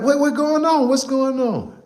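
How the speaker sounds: panicking as the phone call goes wrong; high-pitched alarmed what's going on; alarmed high panic. Retold or imitated. Alarmed reaction to crying on phone.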